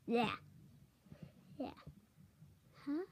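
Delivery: normal talking voice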